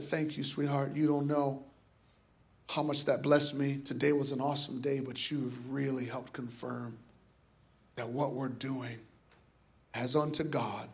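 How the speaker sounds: soft, low, heartfelt gratitude; tender thanks. Retold or imitated. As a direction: thank her gently and quietly, low in pitch. Retells her heartfelt thanks to woman.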